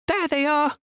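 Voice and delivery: high, loud call across a square; ringing call. A narrator's reading. Esme calling to her partner across the market square, loud. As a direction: call out to be heard across the crowd. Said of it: "Esme's voice rang out".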